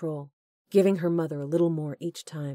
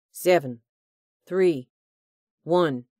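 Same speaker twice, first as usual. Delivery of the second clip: loud taunting count; mocking countdown; 7 dB louder, slower than usual. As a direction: skip numbers with a sneer.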